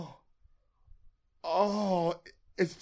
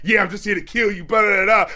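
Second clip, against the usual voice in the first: mock tough gangster; 5 semitones higher, 4 dB louder than usual. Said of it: "it starts off like".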